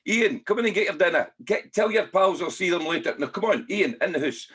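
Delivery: high, loud calling mum. Retold or imitated. Neighbour mum calling the boy in for dinner, loud high call. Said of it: "one of the mums would say".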